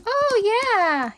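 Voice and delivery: usual speaking voice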